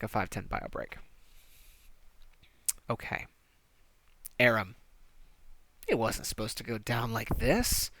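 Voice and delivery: usual speaking voice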